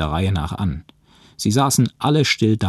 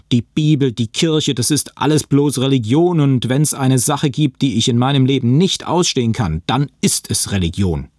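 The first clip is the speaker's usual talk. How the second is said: loud, higher-pitched angry tirade; ranting; 7 semitones higher, 5 dB louder than usual. A narrator's reading. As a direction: rail on, hammering the key words. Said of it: "Ich randalierte weiter". (wütend wetternd)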